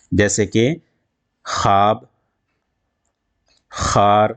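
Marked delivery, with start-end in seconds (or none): 1.4-2.0 خواب said as "khaab", with no w; silent waw. Shown as a demonstration. Teacher giving an example of the unvoiced letter waw, silent waw. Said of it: "ادا نہیں ہوتی". 3.7-4.3 خار said "khaar", with no w; long open aa in خار, waw left silent. Shown as a demonstration. Teacher giving a second example to students, silent waw. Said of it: "ادا نہیں ہوتی".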